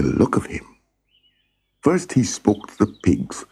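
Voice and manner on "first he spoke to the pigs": ordinary delivery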